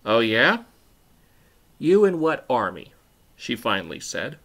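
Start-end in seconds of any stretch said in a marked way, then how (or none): 0.0-2.9 loud, scoffing comeback; taunting challenge. A narrator's reading. Bully Tiffany scoffs at Catherine's threat, taunting. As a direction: challenge them with a rising, mocking question.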